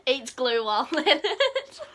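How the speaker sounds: laughing. Real, not acted. Joking through laughter.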